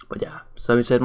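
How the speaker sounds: ordinary delivery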